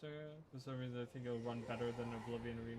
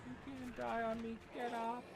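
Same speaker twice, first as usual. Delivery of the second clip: mock despair; 12 semitones higher, slower than usual. Player to fallen Ember in the game, mock-desperate pleading. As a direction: plead in hammed-up anguish with a dying comrade.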